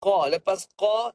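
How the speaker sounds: ordinary delivery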